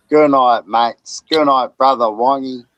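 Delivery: loud, cheery sign-off shouts; loud goodnights. Real, not acted. Vlogger loudly signing off to his viewers, cheery shouts. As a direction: call out goodnight to everyone.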